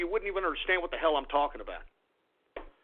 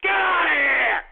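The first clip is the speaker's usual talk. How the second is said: loud, higher furious yell; angry shout; 7 semitones higher, 7 dB louder than usual. Real, not acted. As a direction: yell them off your show. Angry rant at critics.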